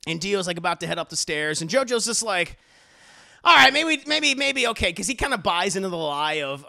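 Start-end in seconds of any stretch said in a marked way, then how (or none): 3.4-4.9 higher, louder grudging maybe; reluctant concession. Retold or imitated. JoJo giving in to Dio, grudging voice. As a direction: concede through gritted resentment.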